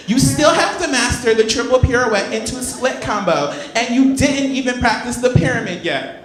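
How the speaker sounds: high, fussy cheerleader scolding her teammate; Glee cheerleader voice. Retold or imitated. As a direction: play a bossy high-school cheerleader late for practice.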